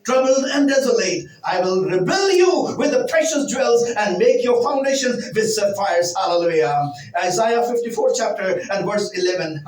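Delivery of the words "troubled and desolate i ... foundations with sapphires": slow, solemn Bible reading; scripture reading. Real, not acted. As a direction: read the verse slowly and solemnly. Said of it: "while I read in English".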